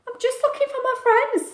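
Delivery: high, small fish voice; little fish voice. Acted. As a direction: sound like a small, lost fish. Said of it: "said Stanley".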